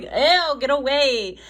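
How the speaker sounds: hammed-up ew; mock disgust. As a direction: playfully shoo someone off. Voicing exasperated lover, laughing.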